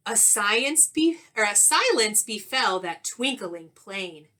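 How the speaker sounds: lively storybook read-aloud with rhyme; reading to children. A narrator's reading. Teacher reading a rhyming story to young pupils, animated. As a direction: read it like a picture book to the class.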